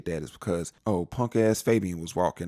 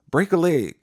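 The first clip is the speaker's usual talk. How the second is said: sarcastic well-wish; 8 semitones higher, 7 dB louder than usual. Retold or imitated. Dismissing a pest.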